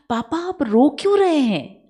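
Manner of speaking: a son worried by his crying father; son asking. Retold or imitated. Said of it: "बड़ा बेटा आता है और बोलते हैं".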